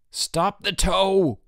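high, long drawn-out roar; father's roar. A narrator's reading. Father roaring to halt the ski tow, desperate drawn-out shout. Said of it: "her father roared".

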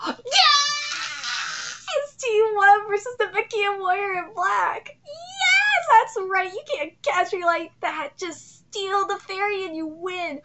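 high, squealing fan shriek; excited outburst. Real, not acted. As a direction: shriek with joy at the reveal. Screams at a manga reveal.

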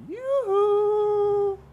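high, loud yoo-hoo; hammed-up high yoo-hoo hail; sing-song call. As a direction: call over the fence, very high and bright. Calling out to neighbour.

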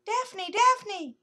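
tiny voices shouting with alarm. Acted. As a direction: shout her name in alarm. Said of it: "tiny voices shouting with alarm".

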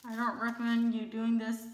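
lips stuck under peel mask; talking while peeling mask; through a face mask. Real, not acted. Seen 0: Peel-off mask covers her lips while she talks, peeling it off.

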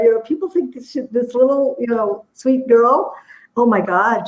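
usual speaking voice

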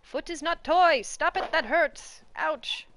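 loud, hammed-up scolding of the cat; theatrical indignation at a biting cat; mock outrage. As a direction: play wounded and furious at the kitty. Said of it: "when I talk like that".

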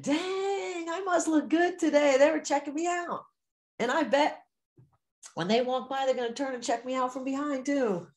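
high-pitched, delighted strutting voice; hammed-up flattered confidence, pitched well up; cocky self-admiration. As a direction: play someone thrilled to be checked out. Acts out a cocky, flattered reaction.

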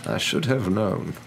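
slow, lightly voiced old man's mutter; amused mutter. Old wizard talks to himself at sight of the cat, muttering. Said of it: "He chuckled and muttered".

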